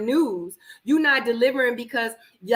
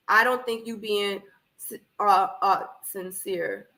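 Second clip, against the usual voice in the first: normal talking voice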